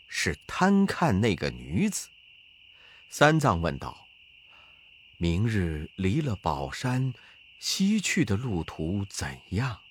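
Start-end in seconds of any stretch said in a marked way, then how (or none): 5.2-9.8 put-on low, soft voice of an old monk; gentle monk. A narrator's reading. Master asks his disciple for food, low and soft. Tang Seng voiced in story.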